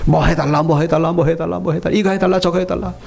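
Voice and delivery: low, repetitive stream of pleas; mimicked prayer requests. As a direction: imitate a crowd pestering God with requests. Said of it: "하나님께 드리는 기도 소리".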